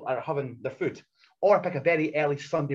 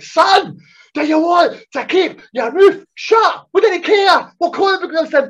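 much higher, louder yelling; blowing up; 13 semitones higher, 11 dB louder, slower than usual. Retold or imitated. Yelling at the chatty geek to shut up, high and loud. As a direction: blow up at him, slow and loud. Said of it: "I just blew up".

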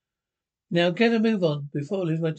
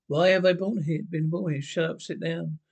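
ordinary talk, nothing marked

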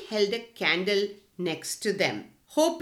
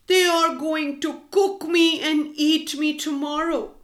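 sad; 6 semitones higher, 4 dB louder than usual. A narrator's reading. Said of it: "he said sadly".

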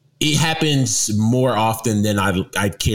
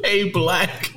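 lightly mimicked, higher-pitched quote; imitating a coworker; 8 semitones higher than usual. Laughing cohost repeats Tony's greeting, mock imitation. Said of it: "is the way he said it".

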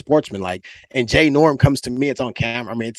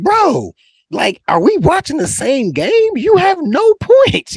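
high, loud mock disbelief, slowing down at the end; mock him for bragging with no points; retold high-pitched incredulous jab; incredulous trash talk; 5 dB louder, slower than usual. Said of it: "And I'm like".

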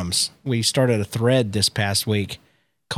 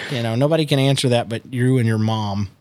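normal talking voice

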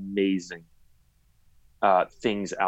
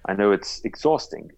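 ordinary delivery

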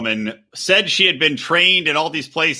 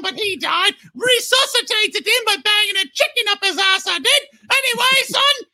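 very high, shouty Cockney mother, like a Muppet; hammed-up Cockney wail from a Muppet Christmas Carol; ridiculous Cockney accent. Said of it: "the most ridiculous Cockney accent".

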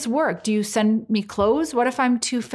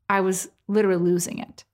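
normal talking voice

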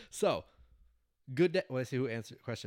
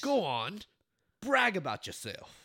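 high, loud mock Aussie; Australian accent attempt; 7 semitones higher, 6 dB louder, slower than usual. Host reading out a fan's message, mock Australian accent. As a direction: egg him on in hammed-up Australian. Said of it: "That's my best attempt at an accent".

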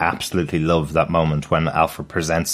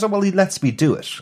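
much higher-pitched retold reply; lightly voiced Esme; 11 semitones higher than usual. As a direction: lightly quote her, pitched well up. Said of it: "She says".

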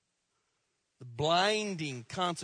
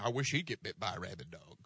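low, soft, fast grumble; congregant's spiteful quip, muttered low and quick; muttered bitter quip; 10 semitones lower, 9 dB quieter, faster than usual. Retold or imitated. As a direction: grumble it low like a sore loser. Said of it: "Somebody said".

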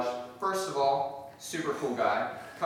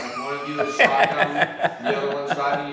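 laughing; 10 dB louder than usual. Real, not acted. Joking about coworkers carpooling.

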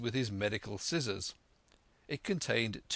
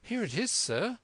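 put-on higher, eager boy's reply; eager young Jim; 10 semitones higher than usual. A narrator's reading. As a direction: answer promptly in a higher voice. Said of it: "said I".

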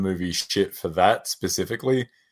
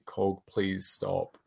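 pleading to Korg; 9 dB quieter than usual. Retold or imitated. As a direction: beg him gently to stop. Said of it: "In this, I'm like".

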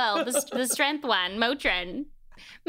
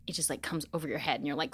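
whisper; 5 semitones lower, 7 dB quieter than usual. Retold or imitated. Describing getting stoned.

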